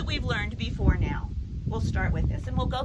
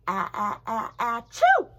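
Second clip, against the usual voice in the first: sneeze; 6 dB louder than usual. Shown as a demonstration. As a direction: let the sneeze burst out on choo. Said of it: "Some people sneeze into their elbows".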